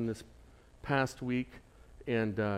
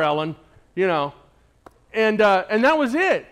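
impassioned preaching; 10 semitones higher, 11 dB louder than usual. Acted. Pastor tells the congregation of his crushing letdown, loudly. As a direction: make them feel the letdown.

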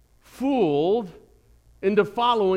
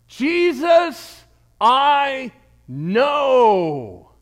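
high, loud shouted demon voice; evil spirit voice; 7 semitones higher, 7 dB louder, much slower than usual. Acted. Preacher voicing the evil spirit, shouted high. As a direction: cry out like a possessed spirit. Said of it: "The evil spirit talks back".

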